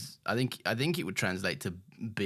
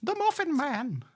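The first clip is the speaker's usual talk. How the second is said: much higher, slow squeaky cartoon voice; Shrek character voice; slower than usual. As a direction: squeak it out like a storybook gingerbread man. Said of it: "You do any good Shrek impressions?".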